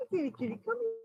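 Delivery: high, soft kitty call. Real, not acted. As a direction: coax the cat gently. Coaxing a cat.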